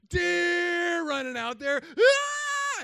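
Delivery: high, slow excited shout breaking into a cry; excited yell. Retold or imitated. Yelling at friends about the deer, high and excited. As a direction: scream it like a thrilled kid. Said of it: "And I'm yelling".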